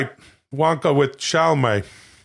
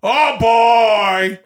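high, loud hammy holler; Robert De Niro doing Al Pacino; 7 semitones higher, 5 dB louder, much slower than usual. Acted. De Niro quoting his friend Al, loud mock Pacino holler. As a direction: belt out a drawn-out actor impression. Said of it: "My friend Al Pacino".

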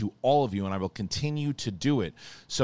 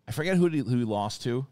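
ordinary delivery

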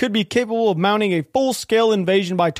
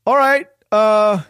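slow, louder drawn-out reluctant okay; resigned; 3 semitones higher, 5 dB louder, much slower than usual. Retold or imitated. To the hotel desk, slow resigned puzzled acceptance. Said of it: "I'm like".

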